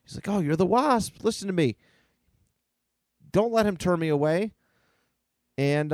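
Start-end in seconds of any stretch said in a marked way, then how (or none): 0.3-4.5 put-on high, breathless-with-hope kid character; excited boy. Boy appeals to the Wasp, acted high excitement. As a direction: pitch up and plead excitedly. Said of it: "boy gets all excited".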